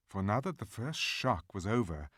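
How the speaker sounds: usual speaking voice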